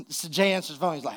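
ordinary delivery

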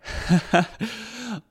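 high, delighted cackle; high gleeful laughter; hearty laugh. Acted. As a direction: laugh with real glee. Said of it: "Lucy lachte aufrichtig".

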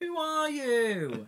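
high, drawn-out mock wail; mock dramatic wail. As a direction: stretch it out high, hammed up. Joins in mimicking film character's outburst.

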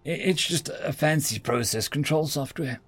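flustered captive programmer. Acted. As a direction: stumble over your words, protesting innocence.

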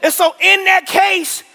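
high, loud, urgent sermon push; preaching at full force. Acted. Pastor urging the church to find a crew, loud. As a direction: drive every line home to the church.

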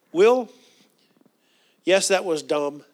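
calling your own name, then admitting it flatly; self-scolding. Shown as a demonstration. Speaker scolding himself to his congregation, wryly. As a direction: own up to yourself. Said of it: "You have to say".

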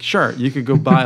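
usual speaking voice

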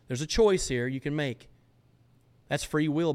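ordinary talk

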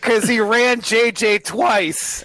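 high, slow, hammed-up proclamation; mock hot take. Giving co-hosts his own hot take, hammed-up proclamation. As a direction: declare it high and drawn out, playing it up.

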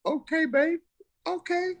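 high-pitched, humoring mom voice; imitating unsure mom. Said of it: "didn't probably know how to respond".

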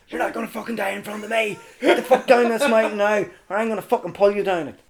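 high-pitched, fast scolding; retold angry order. Rescuer ordering stubborn climber down the mountain, angry. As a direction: order him down forcefully and urgently.

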